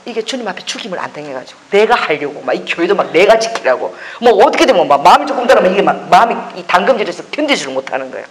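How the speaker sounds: ordinary talk, nothing marked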